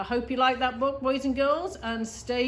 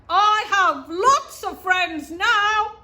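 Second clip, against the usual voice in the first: higher and louder, slow and joyful; loud happy shout; 8 semitones higher, 11 dB louder, much slower than usual. A narrator's reading. Little Beaver shouting his joy across the pond, loud and happy. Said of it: "he said it again very loudly".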